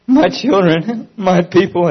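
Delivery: high, loud, slow weeping call; weeping cry. Retold or imitated. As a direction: cry it out through tears, stretching the words. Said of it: "as He wept".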